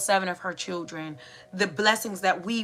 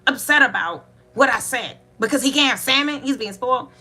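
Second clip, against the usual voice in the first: imitating an exasperated mother, louder and higher; indignant mother; 4 semitones higher, 4 dB louder than usual. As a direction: push out indignant questions as Mom. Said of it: "my mom comes back out".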